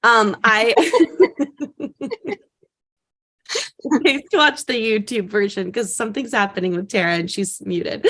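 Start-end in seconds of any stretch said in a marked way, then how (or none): 0.8-2.3 high helpless laugh; laughing fit. Real, not acted. Host losing it as co-host is muted, laughing. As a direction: crack up mid-sentence.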